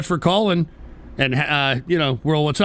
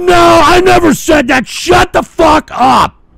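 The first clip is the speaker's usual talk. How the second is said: high, loud furious yell; angry yelling; 13 semitones higher, 11 dB louder, slower than usual. Real, not acted. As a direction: scream at the caller. Host yelling at caller.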